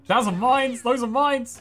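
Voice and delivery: high-pitched, urgent repeated warning; sudden alarm, pitch jumping up. Real, not acted. Sudden alarm at mines in game.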